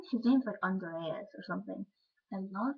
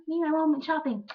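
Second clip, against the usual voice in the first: high, loud, giddy mock voice; gushing, high-pitched imitation; chirpy daughter; 8 semitones higher, 7 dB louder than usual. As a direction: chirp it like a delighted little girl. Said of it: "I'm like".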